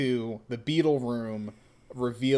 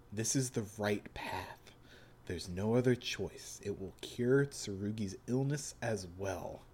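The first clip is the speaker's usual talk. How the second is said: put-on hushed, cold young man; quiet menacing son; 4 semitones lower, 9 dB quieter than usual. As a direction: speak low and intense. Voices Joshu in the reading.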